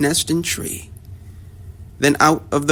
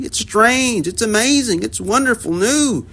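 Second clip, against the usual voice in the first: higher, wonder-struck hunters; amazed hunters; 9 semitones higher than usual. A narrator's reading. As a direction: gush over the sight like astonished hunters. Hunters marvel at elephant.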